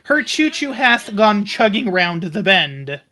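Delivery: ordinary talk, nothing marked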